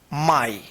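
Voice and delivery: माई with a long ai glide, slow and clear; teacher models माई as my. Shown as a demonstration. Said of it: "इसको क्या पढ़ेंगे?".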